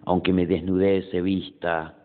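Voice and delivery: measured poem reading with pauses after each line; poetry recitation. Acted. Poet reading own poem Noche to an audience, measured recitation.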